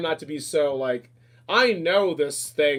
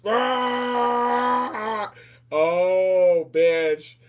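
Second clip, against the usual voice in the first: mock shriek; 5 semitones higher, much slower than usual. Mocking all-caps reaction.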